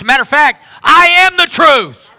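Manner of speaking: preacher voicing Jesus with a booming proclamation. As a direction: proclaim it like a thunderbolt from the pulpit.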